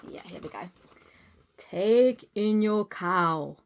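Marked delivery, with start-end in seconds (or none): 1.6-3.6 slow, lightly voiced wise old man. Wise old man advising the lady, slow and calm. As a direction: give calm slow advice. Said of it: "said the wise old man".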